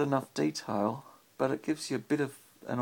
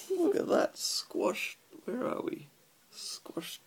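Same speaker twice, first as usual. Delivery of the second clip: hushed aside; 11 semitones higher, 3 dB quieter, slower than usual. Real, not acted. Hushed aside during bedtime story.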